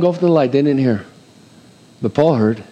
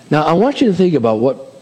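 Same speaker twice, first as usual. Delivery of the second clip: normal talking voice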